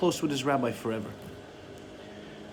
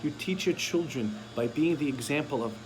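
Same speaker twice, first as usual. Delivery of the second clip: ordinary delivery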